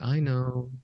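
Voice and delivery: a hint of childlike softness; mildly childlike quoted reassurance; imitated childlike woman. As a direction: lightly mimic a lovestruck, childlike woman. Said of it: "like, childlike again".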